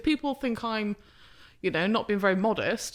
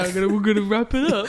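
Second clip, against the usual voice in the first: mock host voice; 5 dB louder, faster than usual. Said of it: "I knew he was gonna fucking say".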